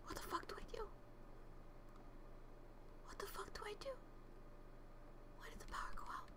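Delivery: breathless scared whisper; frightened whisper. Real, not acted. Streamer hiding in the dark cafe, whispering in fear. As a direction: hush it like something might hear you.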